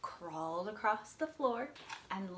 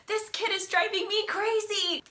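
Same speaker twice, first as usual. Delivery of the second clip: high, exasperated mother cry; 11 semitones higher, 8 dB louder than usual. Acted. Mother fed up with her toddler, exasperated high cry. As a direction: throw up your hands as a worn-out mom. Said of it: "his mother would say".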